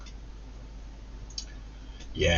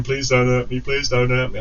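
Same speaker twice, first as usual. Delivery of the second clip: lightly whined imitation of begging; mock tearful plea; 3 semitones higher than usual. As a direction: mimic her pleading with a touch of whine. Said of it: "crying her eyes out saying".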